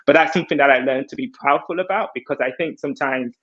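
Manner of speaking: usual speaking voice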